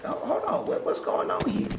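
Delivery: high-pitched, bewildered replay of the moment; alarmed confusion. Retold or imitated. Acting out confusion when it hits again.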